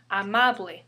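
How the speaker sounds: "amable" stressed on MA; stress on second-to-last syllable. Shown as a demonstration. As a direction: say "amable" as a-MA-ble. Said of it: "The stress falls second to the last syllable".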